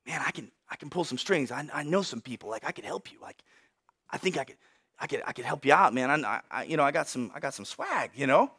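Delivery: low, hushed, furtive pitch; acted whisper. Moses secretly offers help to a Hebrew slave, whispering. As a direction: offer favours secretly, very softly. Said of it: "maybe he whispers to one".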